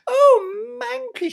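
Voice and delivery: high, slow syrupy simper; a sickly-sweet imitation of Cinderella; mock Cinderella. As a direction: mimic the sweet stepsister, sugary. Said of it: "she'd say to me".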